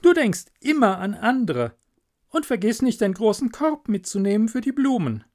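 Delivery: lightly voiced wife, high pitch; high, lively praise and reminder; admiring miller's wife. Wife sending miller off to Hans, admiring.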